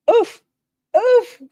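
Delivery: high wincing oof. Real, not acted. Reacting to daughter's rude tone.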